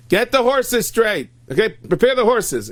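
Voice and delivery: loud, high commands to the driver; barked orders. Acted. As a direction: call out orders across the yard. Acted master ordering his driver.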